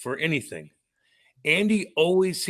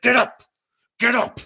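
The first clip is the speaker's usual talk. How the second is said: loud, high mock yell at himself; yelling get up; 9 semitones higher, 6 dB louder than usual. To himself at dawn, a loud high mock yell. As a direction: bark it like slapping yourself awake. Self-wake quote in story.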